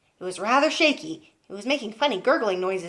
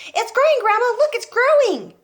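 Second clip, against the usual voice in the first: excited yell; 13 semitones higher, 7 dB louder than usual. Acted. George shouting excitedly.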